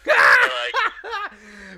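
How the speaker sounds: breaking up mid-story; laughing. Real, not acted. Telling his friend the story, laughing hard.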